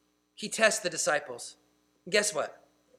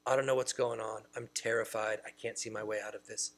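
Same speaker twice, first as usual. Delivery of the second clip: much softer, small frightened voice; mock fear; 10 semitones lower, 10 dB quieter than usual. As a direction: lightly act out being terrified. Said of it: "then we'll be prone to fear".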